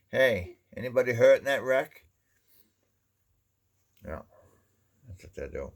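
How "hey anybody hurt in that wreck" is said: higher and louder, mock-concerned teasing; cheeky jab. Churchgoer mocking a battered car, loud cheeky jab. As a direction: needle him about his old car. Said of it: "they'd say".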